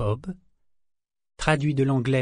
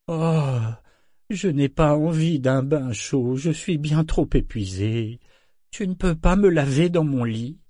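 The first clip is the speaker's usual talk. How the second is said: whiny governor; 4 semitones higher, 3 dB louder than usual. Acted. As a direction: moan like a pampered invalid. Governor whines about bath.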